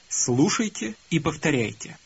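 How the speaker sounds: normal talking voice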